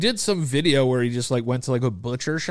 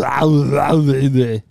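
loud mock action-star gibberish; garbled gibberish; 6 dB louder than usual. Joking the actors need subtitles, loud garbled gibberish. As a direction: bellow slurred, wordless mumbling.

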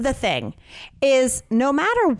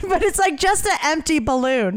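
ordinary delivery

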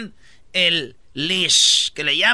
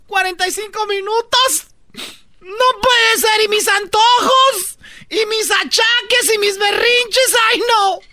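mock whiny lament; 12 semitones higher, 4 dB louder than usual. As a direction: wail it like a spoiled mother-to-be. Acted whiny lament of woman.

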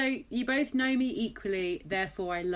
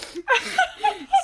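high, loud laughing fit; high shrieking real laughter; real laughter burst; 8 dB louder than usual. Real, not acted. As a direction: laugh helplessly. Parents laughing at the story.

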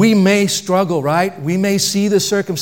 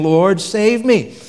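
slow pleading cry, a bit louder; 5 semitones higher, 4 dB louder, much slower than usual. A narrator's reading. Peter sinking in the water, slow pleading cry to the Lord. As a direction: call out for rescue, drawn out. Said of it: "he cried out".

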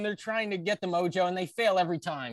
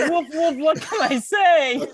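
higher, louder, slow, laughing through it; laughing mock commentator; 9 semitones higher, 6 dB louder, slower than usual. Replaying Taz's line to friends, laughing, higher and slower. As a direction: repeat the joke while cracking up, slowly. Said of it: "Taz on commentary goes".

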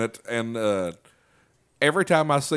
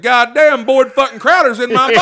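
high, loud amazed exclamation; thrilled squeal of recognition; excited; 12 semitones higher, 10 dB louder than usual. Retold or imitated. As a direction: burst out in delighted disbelief. Excited retelling of own reaction.

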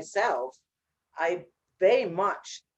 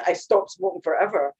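ordinary talk

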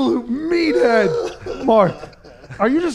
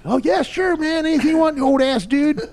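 higher, softer put-on kid voice; mock young gym bro; 5 semitones higher, 3 dB quieter than usual. Young lifter answering old guy at the gym, mock agreeable. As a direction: play the kid humouring an older lifter.

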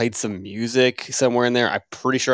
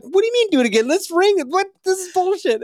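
mock indignant. Protesting to his girlfriend about a redo, high hammed-up indignation. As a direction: squeal out the outrage in a hammed-up way.

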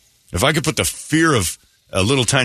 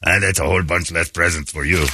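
put-on lower, judging French-accented elf; 5 semitones lower than usual. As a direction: pass sentence on a child in a mock French accent. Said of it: "French accent".

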